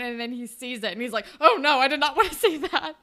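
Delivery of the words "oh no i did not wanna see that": louder, laughing, lightly put-on dismay; mock shocked teacher. Teacher seeing the photo, laughing mock dismay. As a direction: recoil like an embarrassed teacher.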